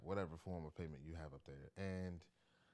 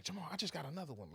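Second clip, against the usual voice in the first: much higher, fast mock mother; imitating panicked mom; 11 semitones higher, 5 dB louder, faster than usual. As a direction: blurt it out like a frantic mom. Son retells mom's call.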